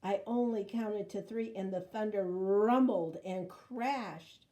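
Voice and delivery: ordinary delivery, nothing marked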